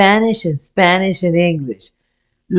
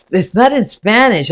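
ordinary talk

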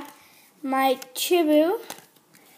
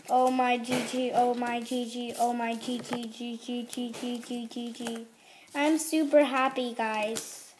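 much softer, dying away; fading hush; 4 semitones lower, 8 dB quieter than usual. Real, not acted. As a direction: let the joy trail off into a hush. Whispered joy fading away.

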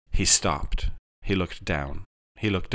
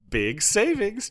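high, stretched-out sales chirp; perky high-pitched cheer; 13 semitones higher, slower than usual. A narrator's reading. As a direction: sing out the bargain slowly. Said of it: "Jarvis chirped".

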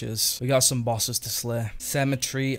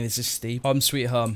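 normal talking voice

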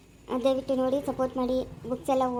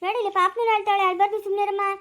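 put-on raised-pitch coaxing of a crying child; hushing a baby; 4 semitones higher than usual. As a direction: hush a wailing little one over the crying.

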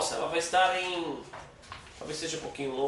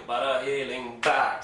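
slow, leaning on the stressed syllable of relembrar; 5 semitones lower, slower than usual. Shown as a demonstration. Teacher showing where the stress falls, slowly. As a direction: stretch it, landing on the beat. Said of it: "Sílaba tônica".

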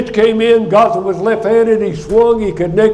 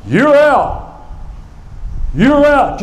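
higher shouted verdict, a touch less full; umpire screaming; 6 semitones higher, much slower than usual. Retold or imitated. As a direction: call it out like a stern umpire. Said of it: "with his fist up there screaming".

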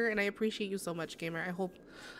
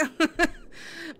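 high laugh; 8 semitones higher, 3 dB louder than usual. Real, not acted. Laughs at own impression.

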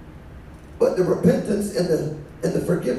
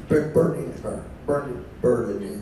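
fading repetition; 9 semitones lower, 5 dB quieter than usual. Acted. Repeated word fading down.

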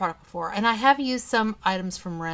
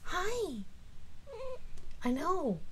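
much higher cooing voice for the cat; 11 semitones higher, 4 dB quieter than usual. Real, not acted. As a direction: coo softly at a pet.

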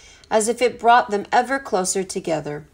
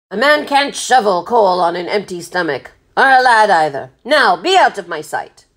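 emphatic sea captain, softening a little; gruff kindly captain; 4 dB louder than usual. A narrator's reading. Captain sending stowaways to eat first, gruffly kind. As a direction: give the order with rough kindness.

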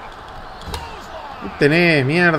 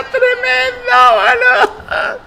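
much higher, slow cartoon squeal; mock cartoon voice praising the hit; put-on squeaky voice; much slower than usual. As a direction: stretch it out in a silly high voice. Mock voice after a big hit.

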